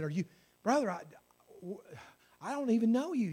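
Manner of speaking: start, stall, then blurt it out in disbelief; baffled. Retold or imitated. Baffled man questioning the stranger who prayed for him, stammering. Acting a baffled man's reply.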